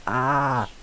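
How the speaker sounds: high, loud startled scream; scared scream. Acted. Donkey hears the ogre say she is the princess, frightened shriek.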